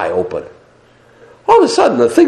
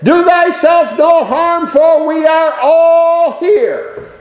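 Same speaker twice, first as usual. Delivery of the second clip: high, slow proclaiming cry; loud cry; much slower than usual. Acted. Preacher quoting Paul to the guard, loud slow cry. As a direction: read the verse as a drawn-out call. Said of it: "Paul cried with a loud voice".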